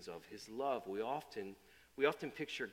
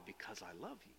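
softer, gentle answer; soft tender reply; 3 semitones lower, 14 dB quieter than usual. Acted. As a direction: let it fall away gently. God answers softly.

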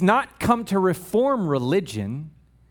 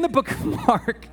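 laughing; 6 semitones higher than usual. Real, not acted.

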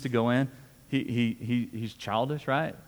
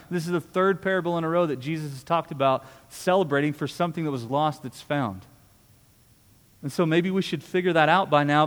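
ordinary delivery

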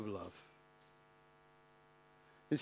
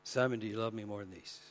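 very low, soft, quick question; gentle questioning; 8 semitones lower, 11 dB quieter, faster than usual. Acted. Jesus asking Simon after breakfast, gentle and hushed. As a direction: ask it quietly and intimately.

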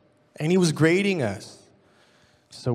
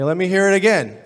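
demanding; 3 semitones higher, 7 dB louder than usual. Retold or imitated. Professor demanding recital again.

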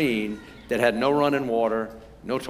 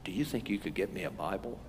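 hushed, low secretive whisper; whispered request; 4 semitones lower, 11 dB quieter than usual. Retold or imitated. Man furtively asking the missionary for a Bible, whispering. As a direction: ask it very softly, like a secret. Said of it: "he whispered to me".

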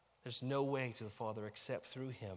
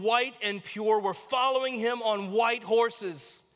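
loud, raised, measured proclamation; forceful scripture reading; 5 semitones higher, 8 dB louder than usual. Acted. Preacher reading Revelation to the congregation, triumphant proclamation. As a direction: declaim it grandly, building in force.

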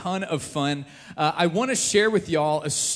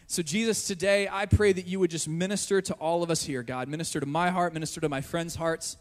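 softer, earnest praying voice; spoken prayer; 4 dB quieter than usual. Real, not acted. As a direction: address God quietly and sincerely. Leads a prayer.

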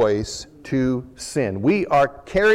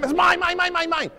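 high squawky seagull chant; greedy seagull squawking; seagull mine mine. Retold or imitated. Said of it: "like that seagull in the Nemo movies".

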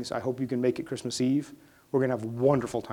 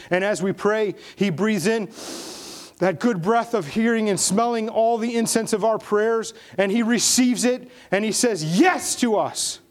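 preaching that climbs much higher and louder to a shout; building to a shout; 10 semitones higher, 5 dB louder than usual. Acted. Pastor climaxes on the cross to the congregation, building to a shout. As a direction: build and build until it bursts out.

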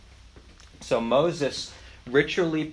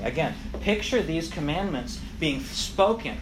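ordinary delivery

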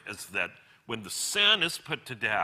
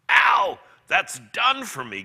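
sudden loud yelp of pain; gasped cry; 8 semitones higher, 10 dB louder than usual. A narrator's reading. Ghost hurt as the lizard is torn off, gasping cry. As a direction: cry out high and loud, as if struck. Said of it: "gasped the ghost".

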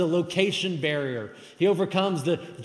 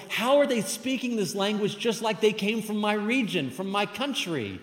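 higher-pitched, incredulous question; amazed; 6 semitones higher than usual. Acted. Listeners hearing their own regional speech, amazed. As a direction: marvel aloud, slowing at the end. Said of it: "they're amazed by it".

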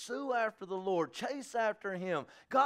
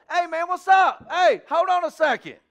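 bright, loud hammed-up hello; mock eager greeting; 11 semitones higher, 13 dB louder than usual. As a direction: play a too-eager evangelist.

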